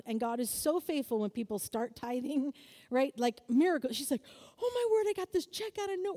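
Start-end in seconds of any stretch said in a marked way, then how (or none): 4.6-6.1 high, breathless amazement, words tumbling out; amazed friend. Retold or imitated. Friend reporting a surprise check, high and amazed. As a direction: gush in high-pitched surprise.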